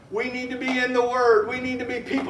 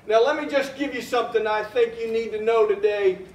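ordinary talk, nothing marked

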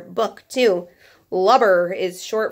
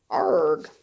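pirate arg growl; 4 semitones lower than usual. Pretend. Pirate growl after matey.

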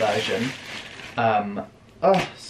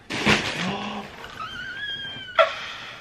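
very high, long shrieking gasp; a drawn-out shrieking gasp of delight; huge shrieking gasp; 6 dB quieter than usual. Real, not acted. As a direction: gasp in shock at the shoes. Seeing the Doc Martens.

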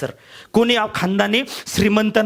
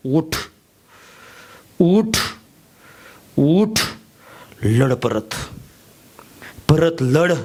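self-urging command; 4 semitones lower, much slower than usual. Acted. Urging himself to get up and fight.

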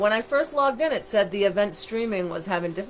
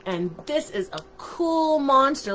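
plain reading voice

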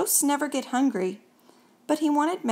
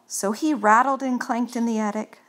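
ordinary delivery, nothing marked

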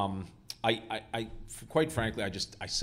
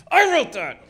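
much higher and louder, proud; smug boast; 13 dB louder, slower than usual. Retold or imitated. Screenwriter boasting at his Oscar at home, smug. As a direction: crow it at the Oscar.

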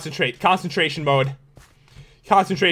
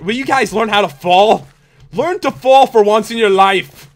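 exasperated scolding; 2 semitones higher, 5 dB louder than usual. Real, not acted. Scolding players who won't fall.

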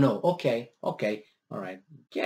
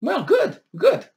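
high, mock-pleased "good, good"; sarcastic good; 3 dB louder, slower than usual. Speaking to Europe about gas prices, sarcastically pleased. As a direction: fake cheer over bad news.

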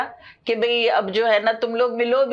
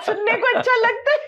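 higher, laughing delight; laughing fondly; 10 semitones higher than usual. Real, not acted. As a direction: laugh as you remember the joy. Fond memory, laughing. (हँसते हुए, खुशी से)

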